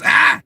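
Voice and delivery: sudden high loud yelp thrown in his face; imitated yell. Mimicking the boyfriend lunging into his face with a loud yell. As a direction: bark a sharp high "Ah!" like he did. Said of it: "Like that".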